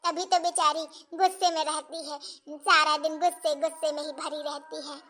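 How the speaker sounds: low, soft motherly lament; subdued, troubled soliloquy, pitched low; worried musing. Acted. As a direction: wonder sadly to yourself about your daughter. Worried mother muses alone about daughter.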